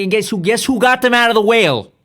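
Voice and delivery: loud insistence. Real, not acted. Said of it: "repeated louder for emphasis".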